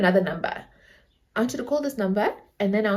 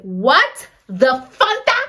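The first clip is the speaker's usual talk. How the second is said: slow, louder, outraged retort rising at the end; angry disbelief; 3 semitones higher, 6 dB louder, slower than usual. Retold or imitated. Told [name] was on the line, outraged disbelief. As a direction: draw out each word in mock outrage. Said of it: "I was so angry".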